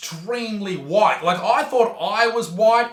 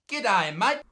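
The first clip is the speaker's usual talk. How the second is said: plain Australian slang; 3 semitones higher than usual. Shown as a demonstration. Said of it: "Don't try and use the Aussie slang".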